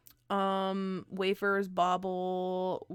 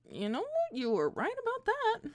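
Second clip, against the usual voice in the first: much higher, mock-chirpy concession; high squeaky voice; 13 semitones higher than usual.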